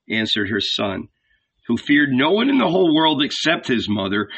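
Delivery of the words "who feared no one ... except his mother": ordinary delivery, nothing marked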